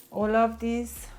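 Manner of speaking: ordinary talk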